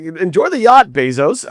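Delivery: high, loud mock congratulations; sarcastic cheer. Author to Bezos over a tiny cheque, sarcastic. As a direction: sing out a sarcastic send-off.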